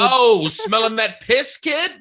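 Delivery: put-on high, slow New York accent. Mocking Lizzie's New York voice.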